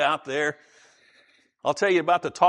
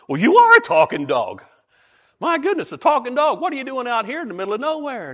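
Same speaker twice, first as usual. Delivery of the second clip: louder and higher in astonished disbelief; amazed; 7 semitones higher, 5 dB louder than usual. Acted. Driver meeting the talking dog, loud and astonished. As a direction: sound floored by a talking dog.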